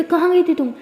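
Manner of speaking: stern father confronting his daughter; angry demand. Acted. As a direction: snap the question at her. Father angrily questions daughter.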